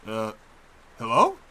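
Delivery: ordinary talk, nothing marked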